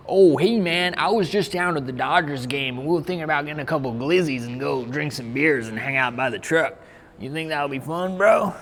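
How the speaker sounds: put-on a chill American dude inviting a friend to a Dodgers game, in an American accent. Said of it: "Can you do an American accent?".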